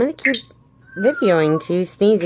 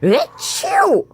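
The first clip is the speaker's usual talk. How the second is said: fake sneeze; 10 semitones higher than usual. Shown as a demonstration. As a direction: sneeze it out for the pet to copy. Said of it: "Let's do the sneeze again".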